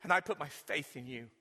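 earnest prayer for the congregation to repeat. Acted. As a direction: lead the prayer phrase by phrase. Leads sinner's prayer.

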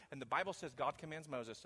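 usual speaking voice

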